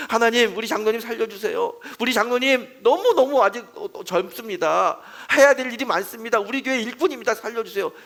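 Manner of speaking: high, loud, urgent intercession; fervent loud prayer. Retold or imitated. Pastor crying out to God to heal the elder, loud and urgent. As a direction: plead hard for a dying friend.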